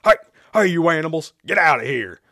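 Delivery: angry farmer's high, loud yell; loud shout. A narrator's reading. Farmer orders animals out of his barn, shouting loudly. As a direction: yell to chase off trespassers. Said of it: "the farmer shouted loudly".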